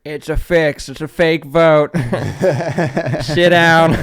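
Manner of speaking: loud, high jeering protest voice; mock outraged yelling. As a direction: shout it like an angry heckler.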